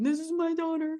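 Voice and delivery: high-pitched mock proud introduction; imitating proud announcement. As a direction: pitch it way up, showing off a daughter to everyone.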